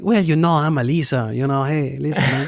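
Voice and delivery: put-on Italian accent slipping into Mexican. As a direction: imitate your wife with a put-on Mexican lilt. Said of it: "became a little more Mexican".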